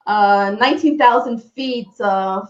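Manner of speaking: ordinary delivery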